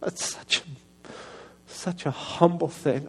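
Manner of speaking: slow, soft, voice nearly gone; choked up. Real, not acted. Preacher moved by his own apology story, choked up. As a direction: struggle to get the words out, slowly. Moved after telling of crying.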